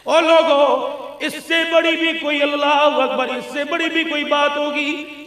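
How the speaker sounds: loud, high cry to the congregation; fervent sermon exclamation; impassioned preaching. Real, not acted. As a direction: call out to the crowd, stretching the opening words. Impassioned preaching outcry to crowd.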